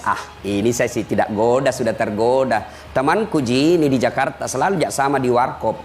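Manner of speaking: low, offhand devil voice; casual devil. Acted. Devil talks about his weak-faith pal, casual. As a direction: play the devil as an unbothered buddy. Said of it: "dia bilang iblis".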